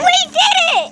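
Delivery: high-pitched victory cry; triumphant. Real, not acted. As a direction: echo the cheer with glee. Celebrating the catch.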